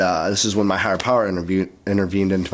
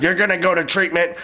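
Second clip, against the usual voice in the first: lightly voiced raspy tough old-timer, pitched up; raspy old-school dude; 9 semitones higher than usual. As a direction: lay down the law with a hint of rasp. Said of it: "really raspy voice".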